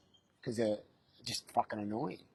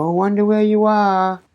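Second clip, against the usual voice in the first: mock searching; 8 semitones higher, 12 dB louder than usual. Pretending not to see toddler.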